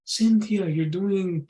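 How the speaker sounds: high, oily mock greeting; creepy guy to a woman. Creepy man greeting Cynthia, high hammed-up sleazy voice. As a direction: overdo the sleazy charm. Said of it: "and they're all creepy".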